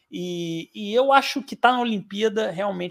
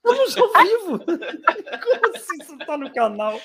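laughing; 11 semitones higher, 3 dB louder, slower than usual. Real, not acted. Laughing at caller's question.

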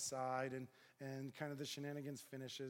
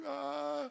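high, loud nervous "ah"; mock panicked wail, held out; alarmed cry; 13 semitones higher, 10 dB louder than usual. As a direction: let out a high cry of dread. Cornered by suspicious biker.

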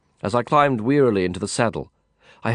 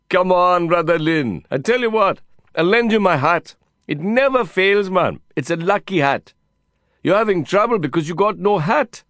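put-on high Indian-accented coaxing; exuberant Indian cowboy; 8 semitones higher, 4 dB louder than usual. Cowboy friend offering his lucky hat, coaxing high. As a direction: coax him eagerly, pitched high. Voices Vikram in the dialogue.

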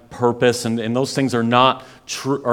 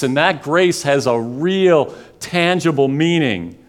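rising, fervent preaching build; 6 semitones higher, 3 dB louder than usual. Acted. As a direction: drive the point home from the pulpit. Passionate preaching climax.

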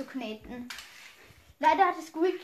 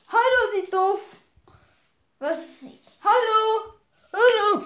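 raised, loud hailing; calling out; 5 semitones higher, 7 dB louder than usual. Acted. Calling to the ringed stranger, loud hello. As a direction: hail someone for attention, higher and louder.

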